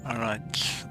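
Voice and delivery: usual speaking voice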